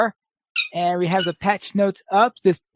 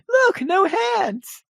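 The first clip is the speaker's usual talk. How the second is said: showing-off rider; much slower than usual. Retold or imitated. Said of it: "I thought he was going to say".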